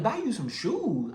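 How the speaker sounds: slow, high disbelieving echo of her demand; incredulous echo. Retold or imitated. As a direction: repeat it back slowly in disbelief. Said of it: "I'm like".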